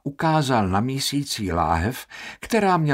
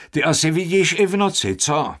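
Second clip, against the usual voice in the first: fast, high, louder sarcastic snap; snapped at him; 7 semitones higher, 4 dB louder than usual. Acted. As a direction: lash out at the boy quickly. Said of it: "vyjel na něho Baldini". (rychle a podrážděně vyjetí)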